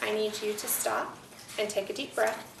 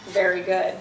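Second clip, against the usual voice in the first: cheerful praise, much louder; 3 semitones lower, 11 dB louder than usual. Real, not acted. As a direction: call out the praise. Teacher praises the reader.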